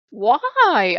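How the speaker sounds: loud, high exasperated why; real loud exasperation; exasperated cry. Real, not acted. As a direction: throw the word up in despair. Exasperated at her own temples.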